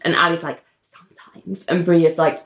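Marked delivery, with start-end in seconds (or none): 1.0-1.6 a hushed, secretive whisper; whispered sometimes. Retold or imitated. Ali admits her fantasies to Bria, whispered. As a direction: breathe it out like a confession.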